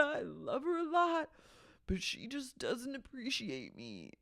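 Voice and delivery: high, whiny self-pity; mock whiny complaining. New dad complaining to the guys, high and whiny. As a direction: whine it like a tired martyr. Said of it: "He goes".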